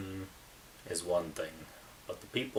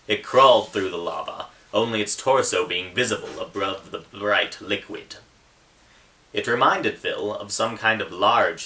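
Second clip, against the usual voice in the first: steady, louder reading voice, building toward the fire scene; story narration; 3 semitones higher, 8 dB louder than usual. A narrator's reading. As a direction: read it like an audiobook that grows tense. Author reads his novel aloud.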